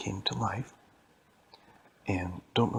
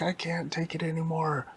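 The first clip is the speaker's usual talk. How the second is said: overwhelmed scientist; 8 semitones higher than usual. Acted. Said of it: "And the guy was like".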